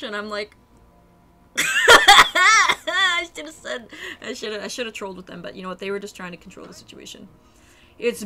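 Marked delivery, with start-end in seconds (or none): 1.5-3.9 high, loud burst of laughter through the words; helpless loud laugh-talk; real laughing. Real, not acted. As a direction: crack up while trying to speak. Bursts out laughing at own story.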